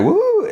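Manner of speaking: high, sustained "woo"; imitated crowd hoot. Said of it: "some hooting and stuff like that".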